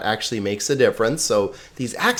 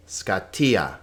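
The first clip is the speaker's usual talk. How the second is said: scatia as ska-TI-a, softer and falling; stress on TI; 3 semitones lower, 5 dB quieter than usual. Shown as a demonstration. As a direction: lean on the middle of scatia. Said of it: "pay attention to the accents".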